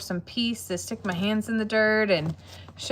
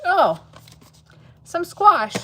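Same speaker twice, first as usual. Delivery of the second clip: bright, louder surprised idea; delighted; 9 semitones higher, 7 dB louder, slower than usual. Real, not acted. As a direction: perk up at the thought. Sudden idea.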